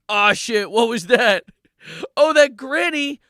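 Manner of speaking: loud, rising mock alarm; hammed-up drama. Comic acts out discovering dead granny, hammed-up alarm. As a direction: build from alarm to high-pitched dismay. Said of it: "drama practice".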